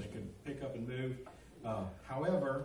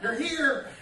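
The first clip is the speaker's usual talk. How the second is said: loud, high, gleeful holler; shouted announcement; 10 semitones higher, 11 dB louder than usual. Retold or imitated. Prankster driver announcing arrival to shaken soldiers, loud high shout. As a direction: shout it out, close to laughing.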